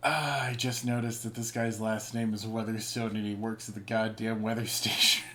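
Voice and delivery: normal talking voice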